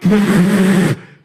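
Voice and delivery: loud, breath-only burst of laughter; wheezing laugh. Retold or imitated. Man laughing in his living room, wheezing. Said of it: "I'm in my living room like".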